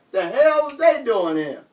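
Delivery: plain reading voice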